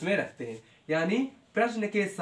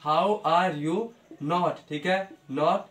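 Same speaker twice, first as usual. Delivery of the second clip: slow dictation; much slower than usual. Shown as a demonstration. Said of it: "teacher dictating slowly".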